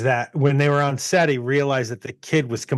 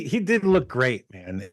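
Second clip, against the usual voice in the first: ordinary talk, nothing marked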